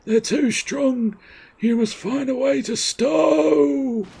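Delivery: high, slow, strained last message; failing soldier. Acted. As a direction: drag out the final word as strength fails.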